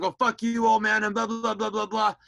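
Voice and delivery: high, loud argumentative gabble; mock angry retort. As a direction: fire back at Dad in a hammed-up row. Re-enacting old fights with Dad.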